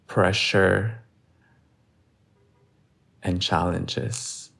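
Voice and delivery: steady yoga instructor, long pauses between phrases; guided meditation. Real, not acted. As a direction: guide them slowly and evenly through each breath. Leads breath hold and meditation.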